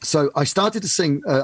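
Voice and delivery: ordinary talk, nothing marked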